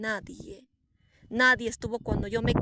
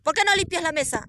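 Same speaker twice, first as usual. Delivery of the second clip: higher, louder and fast, bossy and arrogant; arrogant loud scolding; 6 semitones higher, 4 dB louder, faster than usual. Retold or imitated. The harsh lady scolding her over the ant-covered table, arrogant and loud. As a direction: bark the order like a haughty señora. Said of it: "Con un tono arrogante, fuerte".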